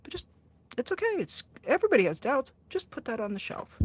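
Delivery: imitating a soothing church mentor; mild reassuring mentor. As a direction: coax a doubter gently, like a church mentor. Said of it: "the person they're studying scripture with says".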